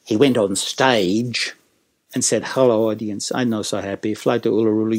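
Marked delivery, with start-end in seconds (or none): 2.4-5.0 miserable mock Italian accent; glum, lower Italian-accented greeting. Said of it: "so he's miserable".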